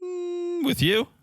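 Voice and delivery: very high coy hum then question; coy, playful disbelief; teasing mock surprise. Real, not acted. Teasing her cohost about taking the class together, high and coy.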